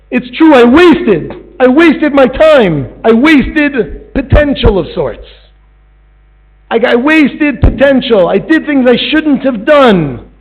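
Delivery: high, loud, slow and pleading; remorseful servant begging; slow anguished confession. Acted. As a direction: drag out every regret. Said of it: "he begs for his life".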